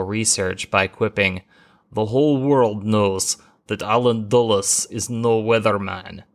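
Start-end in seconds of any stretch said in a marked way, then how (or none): 1.9-6.2 a hammy, lightly put-on Russian accent, quoting a Soviet leader; bad Russian accent. History podcaster quoting Khrushchev's quip, in a bad mock Russian accent. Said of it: "almost as bad as Sean Connery's attempt at a Russian accent".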